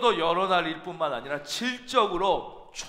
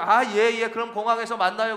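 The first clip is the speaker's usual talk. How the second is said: louder careless yes-yes; dismissive, half listening; offhand brush-off; 3 semitones higher, 6 dB louder than usual. Retold or imitated. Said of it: "건성으로 들은 거예요". (건성으로 대충 대답하는 말투)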